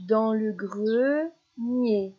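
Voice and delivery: "grenier" slow, split as gre... nier; syllable by syllable. Shown as a demonstration. Teacher isolating the last syllable for a child, "grenier" slowly split. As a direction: hold "gre" before giving "nier". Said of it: "les dernières syllabes qui sont répétées".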